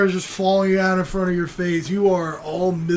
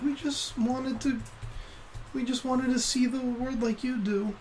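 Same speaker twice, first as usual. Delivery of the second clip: younger sisters' voice; 7 semitones higher, 6 dB quieter than usual. Acted. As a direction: plead gently like a little sister. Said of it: "And they're repeating".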